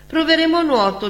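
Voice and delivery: high and quick; eager boy. A narrator's reading. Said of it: "disse Sandrino".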